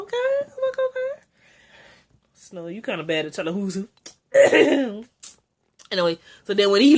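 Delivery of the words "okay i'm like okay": high, soft squeaky okay; mock okay. Reviewer mocking Snow's reaction, high squeaky okay. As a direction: say it high and unconvinced.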